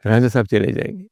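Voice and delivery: ordinary delivery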